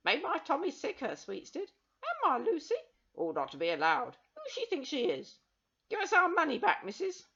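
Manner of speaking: angry crowd. Acted.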